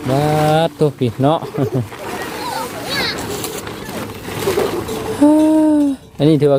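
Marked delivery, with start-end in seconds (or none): 1.4-6.0 high, hearty laugh; real amused laughter; long laugh. Real, not acted. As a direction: laugh it off at the mistake. Long laugh after slip.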